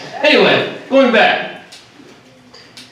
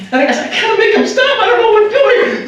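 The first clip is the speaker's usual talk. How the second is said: nervous excitement. Real, not acted. Tense moment facing the buck.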